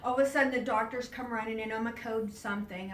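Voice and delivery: usual speaking voice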